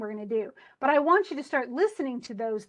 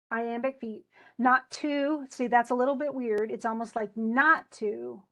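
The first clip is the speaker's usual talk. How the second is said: usual speaking voice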